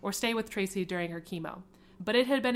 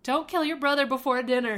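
louder parent call across the house; hollered; 5 semitones higher, 6 dB louder than usual. Acted. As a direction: holler it to the kids in another room. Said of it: "hollered Tracy".

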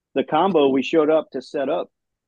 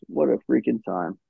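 ordinary delivery

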